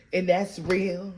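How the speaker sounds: usual speaking voice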